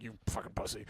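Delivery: grandpa's whispered insult, under his breath; a whispered jab from grandpa; muttered insult. Retold or imitated. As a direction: hiss it out as the old man. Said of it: "my grandpa was just like".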